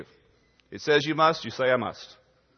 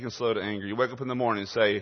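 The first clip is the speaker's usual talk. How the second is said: normal talking voice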